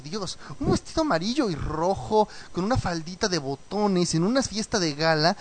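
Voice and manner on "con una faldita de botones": normal talking voice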